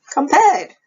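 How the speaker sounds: mock the word in disbelief; scoffing surprise. A narrator's reading. Husband scoffing at the rival's praise, loud and incredulous.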